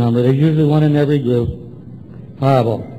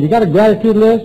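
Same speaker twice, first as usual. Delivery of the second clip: quick, higher-pitched question; quizzing sponsor; 6 semitones higher, 4 dB louder, faster than usual. Retold or imitated. Sponsor checking on the newcomer, quizzing him briskly. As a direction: fire off a sponsor's challenge.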